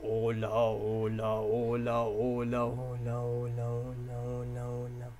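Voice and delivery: slow low hola, hola. Shown as a demonstration. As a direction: drop the voice deep for each hola. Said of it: "háganlo en tono muy grave".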